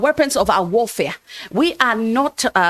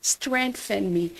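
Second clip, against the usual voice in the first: softer and slow, a drained little request; mock the weak prayer gently, softer and slow; feeble plea; 8 dB quieter than usual. Shown as a demonstration. Pastor mimicking a believer's weak prayer, softer and slow. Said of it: "The other one is".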